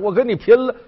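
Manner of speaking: put-on old woman lunging forward with a yell; old woman cries out loud as she throws herself at him; old woman's shout. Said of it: "老太太说：".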